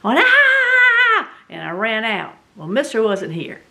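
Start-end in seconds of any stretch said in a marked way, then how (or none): 0.2-1.3 sudden shrill scream, much higher and louder. Retold or imitated. Retelling finding the snake, a high scream. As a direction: shriek in fright at the snake. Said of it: "I screamed".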